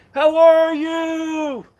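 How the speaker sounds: long, high, loud call across a distance; shouted greeting. Retold or imitated. Plague-era caller shouting to a friend from afar. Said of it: "So you'd shout to your friend".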